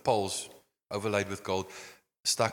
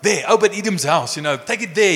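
higher, louder hurried command; urgent order; 8 semitones higher, 9 dB louder than usual. Retold or imitated. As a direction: point and order it out of sight. David ordering the ark away.

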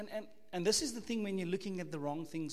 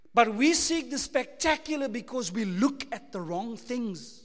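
usual speaking voice

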